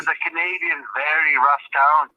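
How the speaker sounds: broad mock Scottish brogue throughout; put-on Scottish accent. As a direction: play a hammed-up Scotsman, aye. Fake Scot on a prank call.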